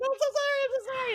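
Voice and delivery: very high, squeaky mock panic; panicked apology, retold. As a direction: apologize frantically in a tiny high voice. Panicked apology after hitting man.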